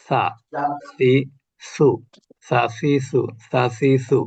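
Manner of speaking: sa si su, each syllable said crisply from the tongue tip; Arabic letter drill. Shown as a demonstration. Teacher drilling Arabic letter sounds for students. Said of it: "এটার মাখরাজ হলো জিহ্বার আগা".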